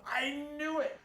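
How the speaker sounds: excited. Retold or imitated. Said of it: "He went".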